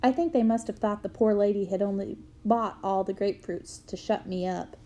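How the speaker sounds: ordinary delivery, nothing marked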